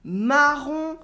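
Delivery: "marron" said carefully, nasal ending lifted; clear French nasal vowel. Shown as a demonstration. Teacher contrasting similar French words for learners, clear nasal vowel. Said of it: "these are very different".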